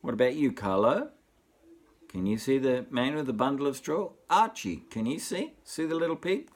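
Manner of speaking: ordinary delivery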